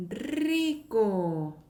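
drawn-out "rico" with a long trilled r; slow, emphatic trill on "rico"; rolled r. Shown as a demonstration. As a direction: roll into "rico" and stretch both syllables. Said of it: "굴리는 발음인데".

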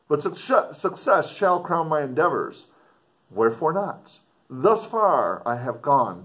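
put-on earnest, dramatic reading of an old letter; story narration. A narrator's reading. Explorer writing home to his sister, dramatic story reading. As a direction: read it like a gothic novel, with feeling.

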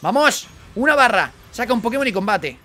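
loud, high urging yells; excited shouting. Real, not acted. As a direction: cheer the fight on at full volume. Urging on a battle.

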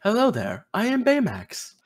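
a hint of a kindly robot nurse; Baymax voice. To his friend, a light Baymax impression. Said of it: "You didn't know I could do Baymax's voice?".

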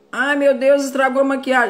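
sudden loud, high cry of dismay; alarmed exclamation. Real, not acted. Makeup artist noticing a slip mid-tutorial, alarmed and loud. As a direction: gasp out that the makeup is ruined.

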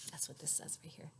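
quick soft whisper. Acted. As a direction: point and whisper to the kids. Whispered aside pointing at text.

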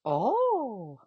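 high, startled oh; surprised oh. A narrator's reading. Grandmother first seeing the strange cat, startled. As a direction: catch your breath at the sight.

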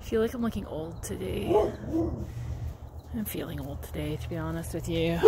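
soft, weary admission; low-energy, quiet and tired. Real, not acted. Vlogger confiding to viewers she feels old, tired. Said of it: "Mostly 'cause I'm tired".

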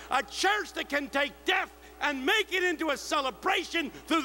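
the preacher's rousing benediction to the congregation; preaching at full force. Acted. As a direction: drive the blessing home to the crowd. Sermon climax at full force.

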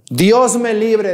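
drawn-out, pleading cry to God. Acted. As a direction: beg heaven to spare her, slowly. Susana cries out to God.

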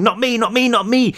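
high, frantic chant; inner scream. A narrator's reading. Brick's panicked thought while sprinting, high frantic chant. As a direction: repeat it in panic with each step. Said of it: "his brain screaming".

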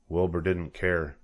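plain reading voice

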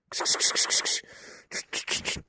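unvoiced kıs kıs and tüt tüt sounds to the dog; breathy pet-calling noises; shooing hiss. Real, not acted.

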